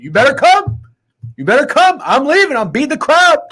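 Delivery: a high, loud put-on voice hurrying his wife; Snidesy voice. Urging his wife to leave the game, in a high put-on voice. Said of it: "That's my Snidesy voice".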